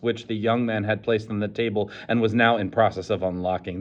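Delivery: usual speaking voice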